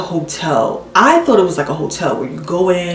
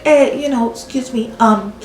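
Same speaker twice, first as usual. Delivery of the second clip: higher-pitched, hesitant broken English; imitating halting English speaker; 6 semitones higher than usual. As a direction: mimic her searching for English words. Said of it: "She can barely speak any English".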